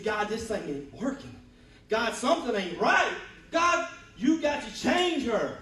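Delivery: building loud, high, slowing plea to God; frustrated plea. Retold or imitated. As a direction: demand that God fix her, rising in frustration. Retold complaining prayer.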